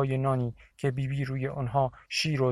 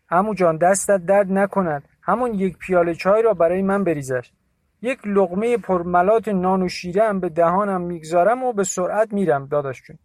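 much higher, louder angry account; Dashi Molla's indignant voice; 6 semitones higher, 7 dB louder than usual. A narrator's reading. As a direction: complain bitterly to Bibi. (صدای مرد خشمگین و بلند)

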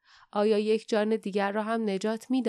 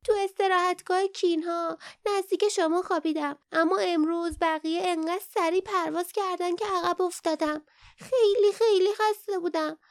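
high, eager little creature voice; happy; 9 semitones higher, 4 dB louder than usual. A narrator's reading. Young Kin telling Lief of the journey, happily. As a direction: chatter it happily in a high, small voice. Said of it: "با خوشحالی گفت".

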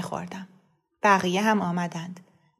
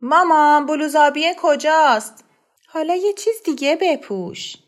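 put-on high, loud child calling for mom; shouting child; 7 semitones higher, 6 dB louder than usual. A narrator's reading. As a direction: call across the house for the blue shirt. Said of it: "فریاد زد".

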